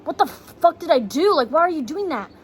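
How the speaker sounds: high, loud retold screaming, furious; screaming at him. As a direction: yell it in shock and rage. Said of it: "I start screaming".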